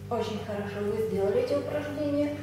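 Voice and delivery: usual speaking voice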